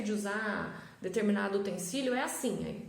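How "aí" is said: normal talking voice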